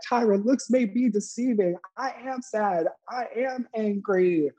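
high-pitched, deliberate impression of a contestant; mock reality-show contestant talking back; Tiffany's retort. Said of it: "she's like".

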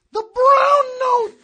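loud, slow ominous declaration; dramatic boom. Acted. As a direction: boom it out like a reveal. Dramatic reveal.

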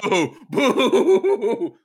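loud, high, drawn-out jeer with laughter; laughing high-pitched boo; mock booing. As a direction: heckle playfully like a hostile crowd. Pretend crowd booing the podcaster.

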